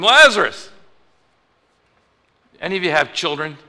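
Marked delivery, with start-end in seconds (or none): 0.0-0.7 loud, high-pitched yell into a tomb; big sudden shout. Retold or imitated. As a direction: call the name out at full volume. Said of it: "he yells into the tomb".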